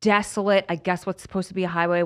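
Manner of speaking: ordinary talk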